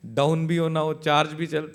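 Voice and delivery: normal talking voice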